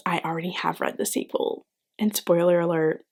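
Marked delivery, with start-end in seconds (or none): 0.1-1.7 whisper. Acted. Secret sequel confession to viewers.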